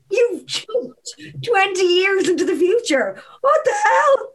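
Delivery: high laughing outburst; high laughing what the hell; laughing disbelief. Real, not acted. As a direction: laugh it out in shock.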